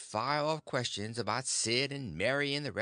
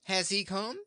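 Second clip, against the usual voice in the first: lightly raised eager aunt; eager question; 8 semitones higher, 3 dB louder than usual. Acted. Aunt rushing to her husband, eager and raised. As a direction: jump at him with the question. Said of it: "she jumps for him and says".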